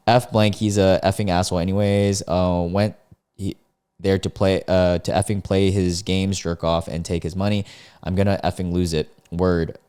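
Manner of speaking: ordinary talk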